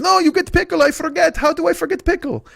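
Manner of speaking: put-on high, eager accented old deli man; high eager voice of the old deli owner pushing a huge sandwich. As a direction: gush like a proud old shopkeeper. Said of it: "He goes".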